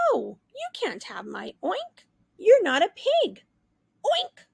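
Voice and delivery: quick, loud, then high oink; refusing pig. Acted. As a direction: turn her down, then oink.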